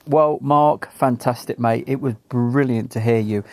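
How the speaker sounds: ordinary delivery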